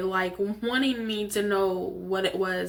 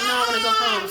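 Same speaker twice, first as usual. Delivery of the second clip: whiny crying; 13 semitones higher, 5 dB louder, faster than usual. Retold or imitated. Retold self, crying upset.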